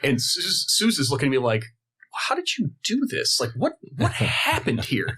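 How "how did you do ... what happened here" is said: starts far higher, astonished; a hint of her stunned question; amazed girlfriend. Girlfriend asking how he arranged it, lightly voiced amazement.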